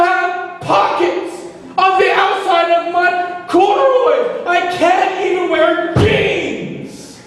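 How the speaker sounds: slow, very high screamed protest; outraged tirade. Retold or imitated. Student ranting at the narc over a pocket rule, outraged scream. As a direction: shriek each phrase in drawn-out disbelief.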